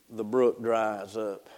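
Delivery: low, slow, falling almost to a murmur; hushed gravity. Real, not acted. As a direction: let the voice sink and slow down, with long pauses. Hushed climax as the brook fails.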